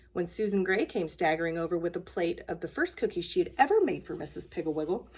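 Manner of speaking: ordinary delivery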